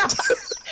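a sudden high burst of laughter; a quick squeaky laugh at yourself. Real, not acted. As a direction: crack up high at your own line. Laughs at his own line.

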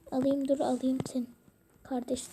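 quick playful whisper. Pretend. Sneaking up to grab the little sibling in a quick whisper. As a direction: hush it fast, like sneaking up.

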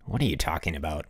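low, quick grumble; growled question. A narrator's reading. Bruno questions his friend, growling. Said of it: "Bruno growled".

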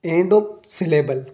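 ordinary delivery

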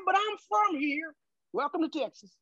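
high-pitched mock Texan cowboy; thick Texan accent. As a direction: cut in with a hammed-up Texas twang. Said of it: "and this thick accent".

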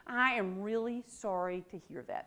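mean every word of the apology; sincere sympathy. Shown as a demonstration. Coworker sincerely sympathizing with a complaining colleague, softly. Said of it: "don't do this unless you mean it".

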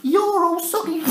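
put-on high teasing child voice; cheeky child taunt. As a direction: tease him again, cheeky and gleeful. Alfie repeats the taunt.

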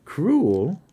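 a high, loud, disbelieving "Cruel?"; stunned echo. A narrator's reading. Blind daughter echoing her father, stunned and disbelieving. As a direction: she can't believe the word she just heard.

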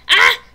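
short, very loud scream; abrupt loud "ah!"; startled yelp. Real, not acted. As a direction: yelp in sudden shock. Startled by attack in game.

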